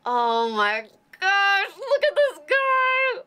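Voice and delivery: very loud, drawn-out, soaring gush; awestruck squeal. Real, not acted. Host revealing the sea dragon's face, loud excited squeal. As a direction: squeal it slowly, pitch shooting way up.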